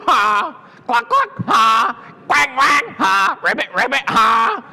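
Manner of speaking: high, loud barnyard noises broken by ha's; laughing farm animals. A narrator's reading. Storyteller voices the other animals laughing at her, raucous animal calls. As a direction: imitate each animal, then its mocking laugh. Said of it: "other animals on the farm laughed".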